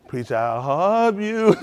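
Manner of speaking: slow, drawn-out mock affection; mock gushing supporter. Preacher imitates a caller offering a hug, drawn-out mock gushing. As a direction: imitate a fawning caller, stretching the words.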